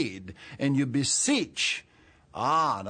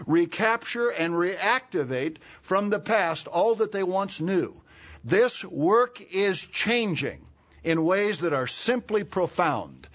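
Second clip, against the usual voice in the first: louder, higher fervent pulpit call; rally the congregation with urgency; preaching; 5 semitones higher, 3 dB louder than usual. Acted. Preaching at full force.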